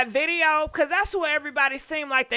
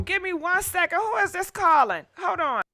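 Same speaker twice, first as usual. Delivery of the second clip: ordinary talk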